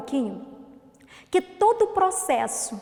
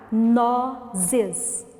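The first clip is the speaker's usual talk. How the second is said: NOZES split as "no... zes", drawn out; syllable by syllable; 3 semitones lower than usual. Shown as a demonstration. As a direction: pause between the two syllables of Nozes. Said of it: "vamos separar as sílabas". (Nozes separado em sílabas, devagar)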